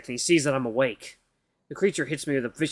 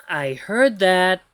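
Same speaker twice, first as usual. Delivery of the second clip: slow, deadpan machine voice; dry AI reply; 4 semitones higher, 5 dB louder, much slower than usual. Acted. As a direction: answer flatly and slowly. AI answers the insult.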